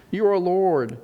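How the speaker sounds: ordinary delivery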